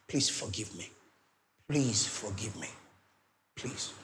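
low, soft pleading; begging apology. Acted. Preacher models begging someone's pardon, hushed and pleading. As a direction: beg forgiveness in a hushed, humble voice. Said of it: "And beg and say".

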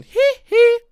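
high, loud pop-star squeal; [name]. Retold or imitated. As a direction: yelp it like the King of Pop. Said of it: "like [name]".

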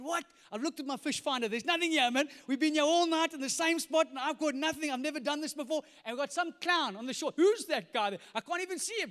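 loud, high exasperated rant; frustrated. Acted. Peter complains after a night without fish, frustrated. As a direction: protest in hammed-up disbelief. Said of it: "he's frustrated".

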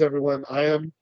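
ordinary delivery

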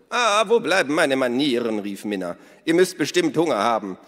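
hohe, laute Stimme einer lebhaften Frau; Minnas Stimme. A narrator's reading. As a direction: Minna ruft die Gäste fröhlich zum Essen. Narrator voices Minna. (hohe, laute Frauenstimme)

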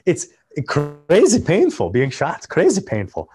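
lightly imitated Chechen mobster accent; Chechen-accented character voice. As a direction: play a polite mobster with a Chechen accent, pitched a bit up. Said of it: "he, he has this accent".

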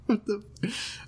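breathless laughing whisper; whispered through laughter. Real, not acted. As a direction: whisper it while cracking up. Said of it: "I was laughing my ass off".

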